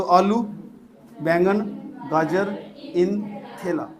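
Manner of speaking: one word at a time, clear pauses between; mnemonic drill. Shown as a demonstration. (एक-एक शब्द रुक-रुक कर)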